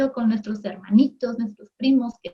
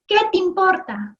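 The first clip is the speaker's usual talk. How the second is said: snapping back; 7 semitones higher than usual. Retold or imitated. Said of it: "Francesca podría reaccionar así, tan enojada".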